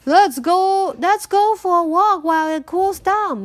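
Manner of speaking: Mama Bear brightly inviting everyone out; cheerful Mama Bear. Acted. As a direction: call the family out for a walk. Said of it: "Mama Bear said".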